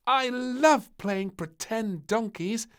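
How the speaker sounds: put-on high, slow happy child voice; laughing princess. A narrator's reading. Princess lying in the sand, high and happy. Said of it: "laughed little princess".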